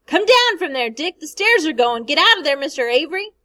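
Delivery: loud, high warning shouts at a man in a burning house; men shouting. A narrator's reading. Men below shouting up at Mister Avery, loud and urgent. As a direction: call him down before the stairs give way. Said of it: "men shouted".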